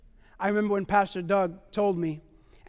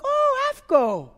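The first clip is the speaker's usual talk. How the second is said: intrigued musing; 5 semitones higher, slower than usual. Retold or imitated. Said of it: "And I'm like".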